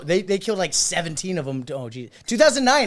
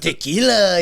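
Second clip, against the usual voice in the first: louder mock Spanish accent; imitated Spanish accent; 2 semitones higher, 5 dB louder, slower than usual. Mimicking a co-host's one-word demo, mock Spanish accent. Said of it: "it's not a Spanish accent".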